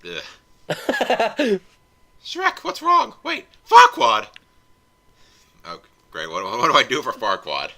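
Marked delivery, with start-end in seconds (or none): 0.0-1.6 ogre groan. Acted. Shrek-voiced groan. 2.2-4.3 much higher, loud alarmed cry; startled cry. Acted. Fiona voiced, sees Farquaad.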